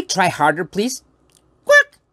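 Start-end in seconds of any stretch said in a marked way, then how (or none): 1.6-2.0 piercingly high, loud quack; puppet duck quack. Pretend. Puppet trying harder to bark, duck quack. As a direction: try harder with one squeaky quack.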